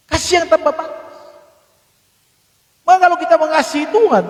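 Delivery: high, ringing preacher's voice; full-force preaching. Acted. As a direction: preach it out to the church with rising fervour.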